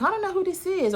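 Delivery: high-pitched mock reluctance; wary at the door. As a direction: act out dodging an unexpected caller. Acts out wary person at door.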